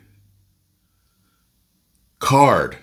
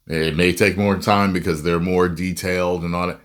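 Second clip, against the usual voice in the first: ordinary talk, nothing marked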